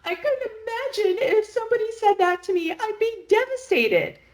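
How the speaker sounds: high, whiny mock sob; mocking a tearful reality star, very high whine; mock crying. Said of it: "on the reunion crying, going".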